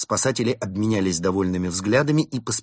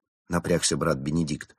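usual speaking voice

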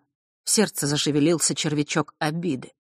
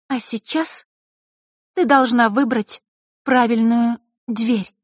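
put-on higher, louder, steady girl's voice; firm girl voice; 9 semitones higher, 4 dB louder than usual. A narrator's reading. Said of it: "В голосе девочки прозвенел металл".

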